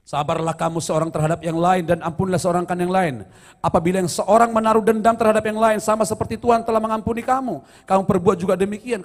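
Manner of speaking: loud, ringing scripture reading; preaching. Acted. Pastor proclaims scripture to the congregation, loud and ringing. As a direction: proclaim the verse to the whole church. Reads scripture at full force.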